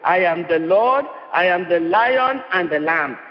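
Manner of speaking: ordinary talk, nothing marked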